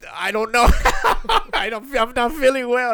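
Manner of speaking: nervous laughing reply; laughing nervously. Retold or imitated. Nervous, laughing while answering.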